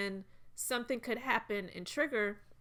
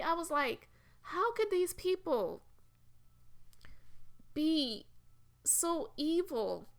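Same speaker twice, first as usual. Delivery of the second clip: higher, strained voice fighting tears; holding back tears; 6 semitones higher than usual. Real, not acted. Host retelling a stolen-baby story to viewers, near real tears. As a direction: keep talking while trying not to cry. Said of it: "Ooh, I'm trying not to cry".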